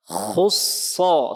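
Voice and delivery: round, heavy "Khusso", drawn out as a model; thick heavy letters. Shown as a demonstration.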